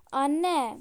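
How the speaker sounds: loud cry of "mom"; protesting shout. Acted. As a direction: shout at your mother in protest. Daughter protests, calls out mother. (annesine bağırarak itiraz)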